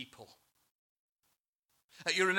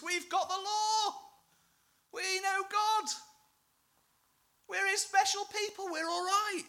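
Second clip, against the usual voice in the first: preen like someone sure of God's favour; much higher smug voice of the self-assured; 4 dB louder than usual. Acted.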